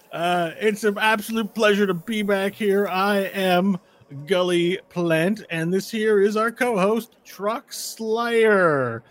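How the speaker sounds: loud announcer voice stumbling over the event name; intercom announcer. Acted. GM voicing the announcer to the players, hammed-up tournament host. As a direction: boom it out like a tournament host on the speaker.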